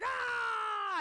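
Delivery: high, loud alarmed scream; short frightened shriek; panicked scream. Retold or imitated. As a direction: let out a sudden yelp of panic. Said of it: "I'm like".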